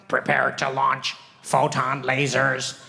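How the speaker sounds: low, slow alien commander voice; lightly voiced alien captain; alien pilot. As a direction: count down the launch like an alien pilot. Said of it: "two aliens in the cockpit".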